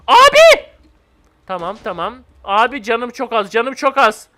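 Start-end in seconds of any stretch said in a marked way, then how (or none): 0.1-0.6 high, loud alarmed yell; panicked shout. Real, not acted. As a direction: shout out as the ground blows. Creepers blowing up under him. (panik içinde bağırış)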